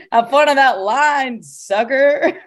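mock-gloat at those left waiting; playful gloating. Traveler with British passport teasing the customs queue, playful gloating.

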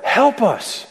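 desperate plea. Retold or imitated. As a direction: beg for help as if at the end of your rope. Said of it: "what they are saying is".

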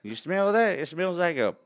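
high, loud hillbilly accent. Shown as a demonstration. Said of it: "call that one the hillbilly one".

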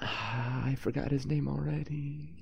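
soft, slow unvoiced whisper; whispered aside. [name] admitting he forgot the name, slow whisper. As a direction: let it out as a defeated aside.

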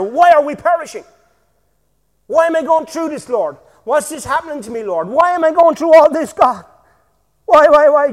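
loud, high complaining cry; whining plea. Acted. Believers complaining to God in trouble, loud whiny plea. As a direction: moan at God dramatically.

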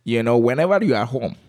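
put-on amused, thick Calabar accent. Comedian advises on being at home, thick Calabar accent. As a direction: lay the Calabar accent on for laughs. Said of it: "a very thick Calabar accent".

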